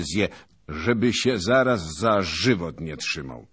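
pompous veteran holding forth at dawn; boastful old noble. A narrator's reading. Zagłoba boasting to his fellow knights, booming and pompous.

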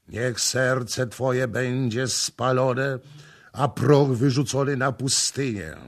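ceremonial curse. A narrator's reading. Next priest repeating the ceremony, solemn curse. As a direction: intone a ritual condemnation. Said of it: "powtarzając ceremonię".

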